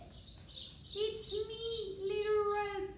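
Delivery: wolf faking a sweet voice. Acted. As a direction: pitch it up to fool granny. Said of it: "replied the wolf".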